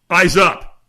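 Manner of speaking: loud, sudden retold order; trainer's sharp command. Trainer to a transfixed firewalker, loud sudden command. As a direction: startle them with a loud command. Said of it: "the trainer goes".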